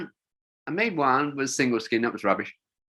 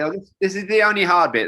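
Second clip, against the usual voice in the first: a touch louder, lightly voiced young guide; breezy guide's reassurance; 5 semitones higher, 5 dB louder than usual. Young guide reassuring the scared older jumper, breezy. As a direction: toss it off like it's nothing, mock-casual. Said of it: "That was a famous line".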